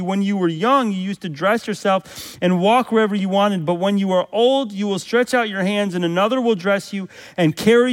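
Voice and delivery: fervent sermon building again; preaching. Acted. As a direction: lift the congregation with the promise. Preaching Jesus restoring Peter.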